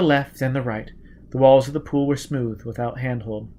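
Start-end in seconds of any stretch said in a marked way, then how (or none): none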